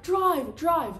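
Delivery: high, loud repeated command; re-enacted frantic urging; urgent shout. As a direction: yell at the getaway driver. Re-enacts urging getaway driver.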